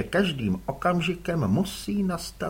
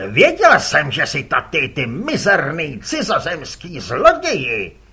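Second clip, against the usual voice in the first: angry shout; 8 dB louder than usual. Acted. Kuboš yells at the foreign rival, angry shout. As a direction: bellow at the thief across the water.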